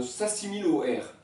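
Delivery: normal talking voice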